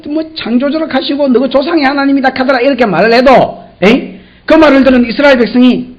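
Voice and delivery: ordinary delivery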